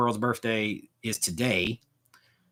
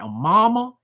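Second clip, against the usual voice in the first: imitating a Southern drawl, pitched up; mock Southern "Oh, mama" in a film drawl; quick Southern movie-accent impression of Dan Aykroyd; 9 semitones higher, 5 dB louder than usual. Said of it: "Dan Aykroyd is like".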